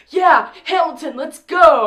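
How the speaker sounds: loud, high retold fan cheer; excited cheering. Retold excited audience reaction.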